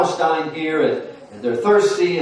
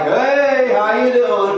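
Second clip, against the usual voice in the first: higher, louder mock-friendly hello; cheery greeting; 9 semitones higher, 5 dB louder, much slower than usual. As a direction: hail the newcomer like old pals. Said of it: "And they're like".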